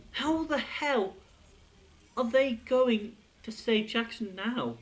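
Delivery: slow, high, stretched-out wonder; lingering incredulous question; drawn-out disbelief. Real, not acted. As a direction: let each word hang in suspense.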